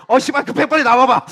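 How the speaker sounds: loud, hammed-up urgent demand; impatient urging. Desperate person outside an occupied toilet, loud impatient urging. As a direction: hurry them out, blurting it loudly.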